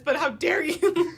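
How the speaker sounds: loud, slow how dare you through a laugh; hammed-up indignation while laughing; laughing mock outrage. Podcaster joking about a stranger touching the singer's mullet, loud laughing outrage.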